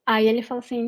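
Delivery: normal talking voice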